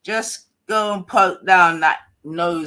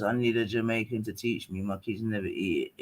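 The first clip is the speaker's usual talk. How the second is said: usual speaking voice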